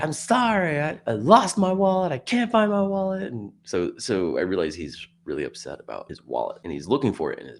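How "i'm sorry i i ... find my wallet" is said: high, pleading imitated voice; distraught man. Man who lost his wallet, imitated pleading. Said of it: "he just says, like".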